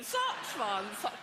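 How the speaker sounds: posh catchphrase through a fixed grin; rictus grin. Retold or imitated. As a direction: repeat it with forced posh jollity. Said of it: "with a rictus grin".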